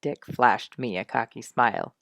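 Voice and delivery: usual speaking voice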